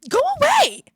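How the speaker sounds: high, loud yell; shouted dismissal. Real, not acted. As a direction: yell to drive someone off. Yells at an interruption.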